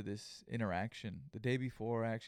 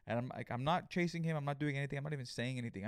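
ordinary delivery

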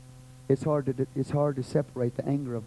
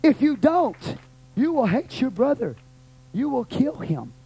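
slow, then much higher and louder, shouting; building preaching shout; 10 semitones higher, 7 dB louder, slower than usual. Real, not acted. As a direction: build the exhortation to a full-force climax.

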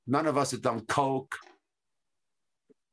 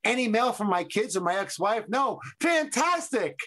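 acting out the character; 8 semitones higher than usual. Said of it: "He asks the mailman".

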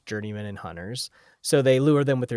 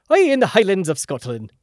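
put-on joking, louder, higher Scottish accent that slips towards Irish; botched Scottish brogue, pitched up; mock Scottish accent; 6 semitones higher, 6 dB louder than usual. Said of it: "That was more Irish".

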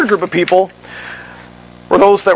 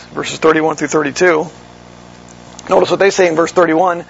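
normal talking voice

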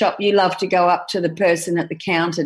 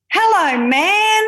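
put-on child calling out; 10 semitones higher, 5 dB louder, slower than usual.

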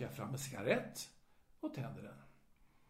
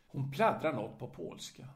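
plain reading voice

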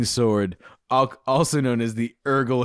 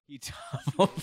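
laughing; 4 semitones higher, 6 dB quieter than usual. Real, not acted. Laughing while rereading the line.

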